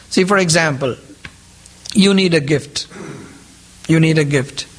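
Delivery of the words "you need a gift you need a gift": usual speaking voice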